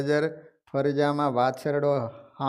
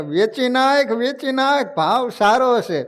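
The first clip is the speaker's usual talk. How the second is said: higher, louder calls to sell now; loud urgent orders; 7 semitones higher, 4 dB louder than usual. Acted. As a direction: shout at the sons to sell the gold. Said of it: "જોર જોરથી કહેવા લાગ્યો".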